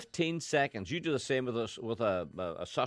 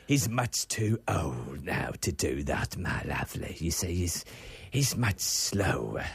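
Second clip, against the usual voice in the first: slow, lower aging entertainer voice; Bruce Forsyth impression; 7 semitones lower, slower than usual. Judged by co-host, a slow low Bruce Forsyth. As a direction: drag it out slowly like an old host. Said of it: "That's not bad".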